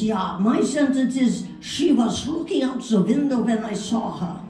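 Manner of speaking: hammed-up German accent, teaching a practice line. Hal giving her a practice sentence, German accent. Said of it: "I could do a German accent".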